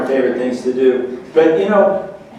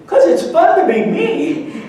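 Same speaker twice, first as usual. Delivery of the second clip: put-on little boy's voice; 11 semitones higher, 4 dB louder, slower than usual. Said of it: "he looks at me and goes".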